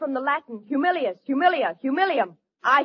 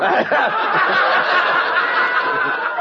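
loud breathy laughter; 6 semitones higher, 5 dB louder than usual. Real, not acted. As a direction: laugh at her own joke. Actress laughs at own joke.

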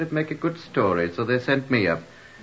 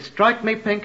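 quoting the landlord; 8 semitones higher, slower than usual. Retold or imitated.

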